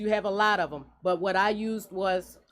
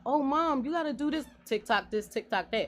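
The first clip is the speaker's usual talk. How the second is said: put-on eager kid voice; 6 semitones higher than usual. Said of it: "he's all about".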